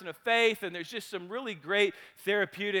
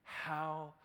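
hushed moved storytelling; 6 semitones lower, 8 dB quieter than usual. Real, not acted. Storyteller recounting the big man breaking down, hushed. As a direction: hush the room for the tears.

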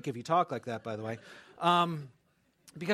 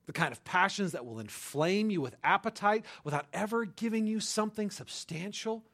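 normal talking voice

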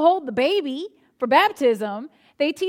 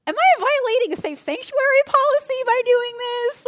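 panicked thought; 11 semitones higher, 6 dB louder than usual. Retold or imitated. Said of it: "I'm thinking".

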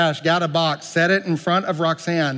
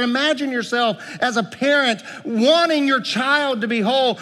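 ordinary talk, nothing marked

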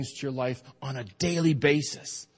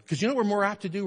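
ordinary talk

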